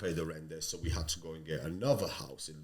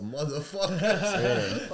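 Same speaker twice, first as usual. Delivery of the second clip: drawn-out, high, louder curse through laughter; half-laughing outrage at the headline; 9 semitones higher, 6 dB louder, much slower than usual. Retold or imitated. Retelling his reaction to the Daily Mail story, laughing.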